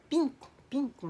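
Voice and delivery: PINCO repeated high and clear; teacher modelling a word. Retold or imitated. Japanese coworker teaching a colour word, high modelling voice.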